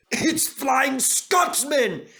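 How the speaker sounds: high, slow, amazed cry; excited cry. A narrator's reading. Gordon seeing his famous brother arrive, crying out high. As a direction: gasp out the wonderful news. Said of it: "he cried".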